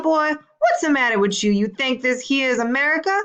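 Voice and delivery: put-on Southern US accent, pitch climbing on the taunting questions; Southern style voice. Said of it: "in his best Southern style voice".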